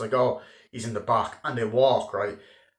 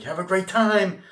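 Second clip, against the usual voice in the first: fake cheerful farewell; 9 semitones higher, 5 dB louder than usual.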